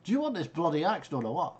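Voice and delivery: exasperated northern demand, loud and high; northern-accented irritated god. Acted. Busy god nagging the caller about the axe, loud northern exasperation. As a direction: complain loudly in a northern English accent. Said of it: "I've gone all northern".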